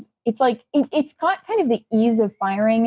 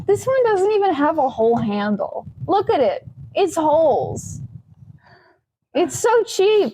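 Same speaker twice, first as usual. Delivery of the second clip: higher, scoffing at cheap build; mock the flimsy toy; scornful; 6 semitones higher than usual. Real, not acted. Mocking the cheap gun.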